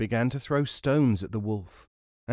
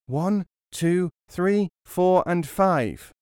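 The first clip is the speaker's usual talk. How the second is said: put-on high, loud childlike counting; loud echo; 7 semitones higher, 5 dB louder, much slower than usual. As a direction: shout the numbers back as loud as possible. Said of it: "as loud as he was able".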